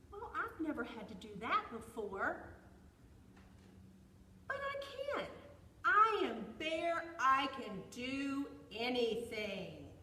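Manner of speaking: loud bear, swelling with confidence; boastful bear. A narrator's reading. Bear accepts squirrel's challenge, loud and boastful. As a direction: build to a loud, stretched-out boast.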